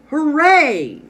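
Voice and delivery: high, very loud, long cheer; excited cheer. Shown as a demonstration. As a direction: cheer the winning play at full voice. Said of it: "read with excitement".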